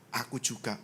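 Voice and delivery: low, soft and understated; quiet divine reply. Retold or imitated. God answers the speaker about love, quiet low reply. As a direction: let it drop softly as God's answer.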